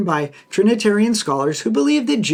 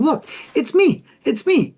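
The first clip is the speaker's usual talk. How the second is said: voicing the healed man; 9 semitones higher than usual. Man born blind identifying himself.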